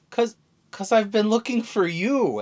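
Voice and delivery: lightly voiced upset girl; Bonnie voice. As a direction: Bonnie telling Luffy she searched for him, lightly pleading. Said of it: "Bonnie's like".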